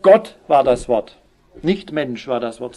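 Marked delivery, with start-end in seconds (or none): 0.0-1.2 "Gott" put first and stressed, louder and slow. Shown as a demonstration. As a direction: lean on "Gott" at the start, slowly. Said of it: "weil es eben gerade die Betonung ist".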